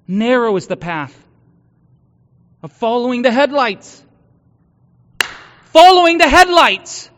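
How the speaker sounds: preaching. Acted. Pastor to congregation about following headlights, preaching at full force.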